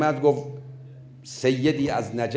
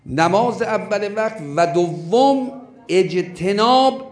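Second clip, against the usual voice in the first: slow, raised and louder, stress on دوم; emphatic preaching; 6 semitones higher, 5 dB louder, slower than usual. Shown as a demonstration. Preacher listing the two counsels to the congregation. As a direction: hammer out each point with دوم punched. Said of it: "اهم سفارش هاش دو چیز بوده".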